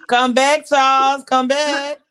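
loud high call after him; yelling across the room. Pretend. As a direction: shout for him to come back. Calling after departing guest.

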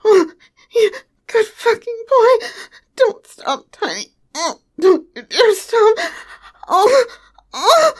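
breathless escalating moans; moaning, building. Acted. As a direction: let the moans build and break. Building toward climax, moaning.

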